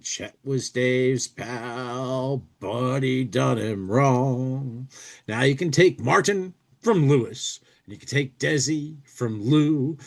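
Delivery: ordinary delivery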